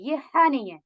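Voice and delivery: normal talking voice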